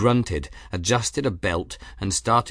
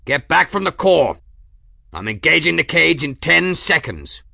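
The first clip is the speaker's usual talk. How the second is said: loud, high urgent warning; shouted warning; 7 semitones higher, 7 dB louder than usual. A narrator's reading. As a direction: warn everyone back. Engineer warning crew before engaging.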